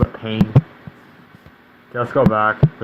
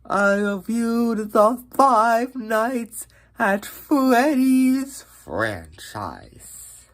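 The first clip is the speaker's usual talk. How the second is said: high, drawn-out announcer intro; dramatic announcer voice; 11 semitones higher, much slower than usual. Acted. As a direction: stretch it out like a show title.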